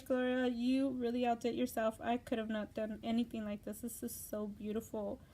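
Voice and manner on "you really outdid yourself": usual speaking voice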